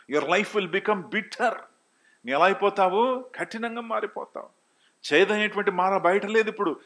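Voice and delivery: high, loud preacher's delivery; full-force preaching. Real, not acted. (గొంతు పెంచి ఉద్వేగంగా ప్రసంగం)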